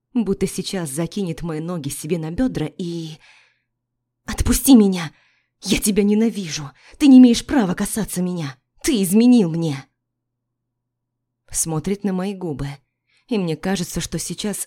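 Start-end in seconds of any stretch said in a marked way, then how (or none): 4.2-9.8 much louder, desperate yelling; wife screaming her hatred at her unfaithful ex; furious scream. Acted. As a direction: scream it at him, pinned and raging. Wife screams, held against wall.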